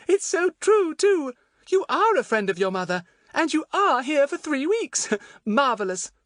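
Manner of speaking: very high, admiring little voice; excited Betts, delighted. A narrator's reading. Betts to Fatty about his story, delighted high voice.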